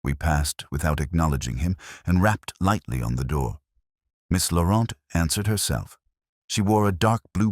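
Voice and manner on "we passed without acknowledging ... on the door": usual speaking voice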